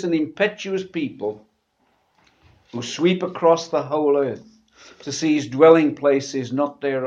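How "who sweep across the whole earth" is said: ordinary talk, nothing marked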